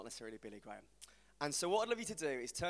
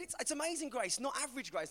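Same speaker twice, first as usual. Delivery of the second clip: high-pitched, animated pleading; earnest protest; 8 semitones higher than usual. Retold or imitated. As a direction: plead your case to a mate. Explaining his loud singing to friend.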